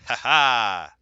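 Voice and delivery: loud gleeful "ha ha"; triumphant laugh. Real, not acted. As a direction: crow over the win. Wins rock paper scissors round.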